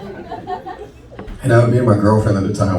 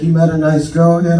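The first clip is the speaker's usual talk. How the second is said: aunt voice; 8 semitones higher, 3 dB louder than usual. Retold or imitated. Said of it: "She's like".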